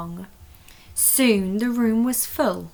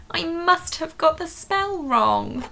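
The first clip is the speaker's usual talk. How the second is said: higher, small guilty voice; a child owning up in a high voice; high sheepish; 9 semitones higher than usual. Acted. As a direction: sound caught out. Agnes admits mistake.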